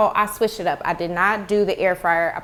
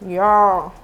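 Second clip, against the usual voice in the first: mouth full; 3 semitones lower, 3 dB louder than usual. Real, not acted. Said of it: "Sorry for talking with my mouth full".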